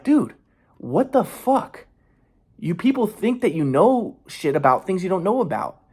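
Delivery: angry protest at people who judge; real anger. Real, not acted. Man fed up with others' assumptions, angrily exasperated. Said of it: "so angry, but".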